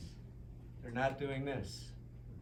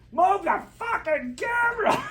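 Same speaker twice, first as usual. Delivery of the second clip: much higher, loud yelled order; angry shout; 9 dB louder than usual. Retold or imitated. Gaffer Willie yells at the director across the water, angry shout. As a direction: yell it across the lake at the director. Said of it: "suddenly I hear Willie go".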